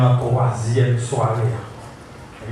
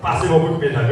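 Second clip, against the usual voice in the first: ordinary delivery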